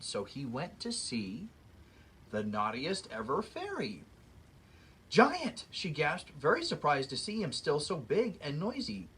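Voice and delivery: lively read-aloud storybook voice; story narration. A narrator's reading. Reading a fairy tale to children, lively storyteller voice. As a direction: read it like story time for kids.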